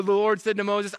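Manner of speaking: ordinary delivery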